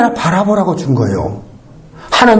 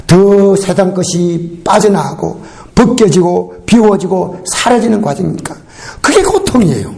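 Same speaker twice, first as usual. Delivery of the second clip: ordinary talk, nothing marked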